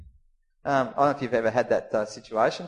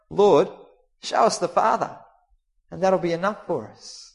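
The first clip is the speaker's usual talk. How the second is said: high, loud plea from Philip; pleading demand; 5 semitones higher, 4 dB louder than usual. Acted. Disciple Philip pleading loudly with Jesus to see God. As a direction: beg to be shown God outright.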